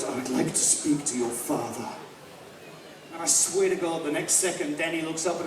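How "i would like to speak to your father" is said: low, soft, measured film-hero voice; Obi-Wan Kenobi. Retold or imitated. Jedi asks boy for his father, low and soft. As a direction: imitate a composed Jedi, pitched right down. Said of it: "Ewan McGregor playing Obi-Wan Kenobi, he goes".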